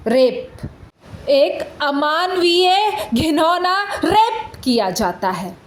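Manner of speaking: outraged condemnation. Acted. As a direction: hit each damning word with outrage. Lawyer condemns the crime.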